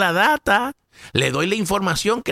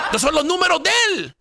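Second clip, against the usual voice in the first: laughing shout; 13 semitones higher, much faster than usual. Real, not acted. Se ríe mostrando los números.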